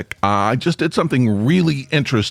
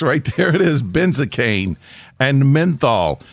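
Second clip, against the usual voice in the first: normal talking voice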